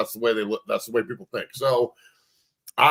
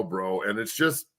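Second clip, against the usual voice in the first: usual speaking voice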